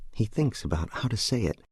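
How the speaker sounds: usual speaking voice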